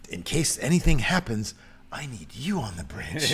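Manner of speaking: soft, patronizing reassurance; coddling. Retold or imitated. Picard coddles Crusher in case anything happens, condescending. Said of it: "He just, like, coddles her".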